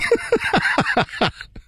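high, breathless burst of laughter; long delighted cackle; big laugh. Real, not acted. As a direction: crack up at the surprise. Reacts to the Jif reveal.

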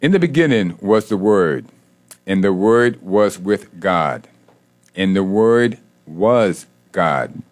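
slow, weighty scripture reading. Real, not acted. Host reading John to listeners so it resonates, very slowly. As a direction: let each phrase resonate, pausing between them. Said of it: "I'm gonna read it very slow".

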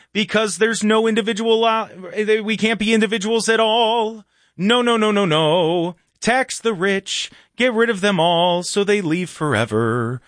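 hammed-up follower reciting slogans; mock idealist. Continues parody of idealists.

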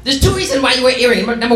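high mock strict mom; mocking a strict mom, no earrings allowed. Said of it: "She's like".

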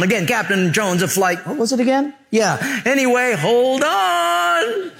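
high-pitched mock airline pilot announcement; Captain Jones. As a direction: play a clueless pilot rambling over the intercom. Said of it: "it sounded kinda like this".

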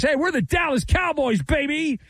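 cocky boast. Retold or imitated. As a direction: puff up like a team that thinks it's the biggest. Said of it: "the English Premier League thinks".

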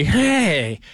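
half-hearted drunk impression calling for a ride; Harry Carey. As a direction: ham up a tipsy late-night call for a ride. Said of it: "I'm not Harry Carey".

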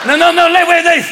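giddy high cardinal talking over himself; stoned cardinals. Retold or imitated. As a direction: pitch a crazy idea while high and giggly.

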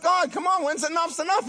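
high-pitched, fed-up appeal to God; exasperated plea. Acted. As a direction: plead with God for a break.

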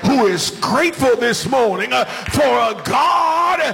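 high, chanted, shouted sermon climax; full-force preaching. Acted. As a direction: whoop it up over the organ. Preacher builds into whooping climax.

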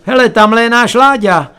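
calling out. Acted. Relatives spotting the boy from upstairs, calling out. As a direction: spot a relative and call out. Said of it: "ozvalo se najednou z hora".

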